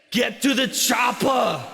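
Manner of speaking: slow, booming Austrian action-hero shout; Arnold Schwarzenegger impression. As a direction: bellow it like an Austrian action star. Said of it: "some other accent while I was doing Arnold Schwarzenegger".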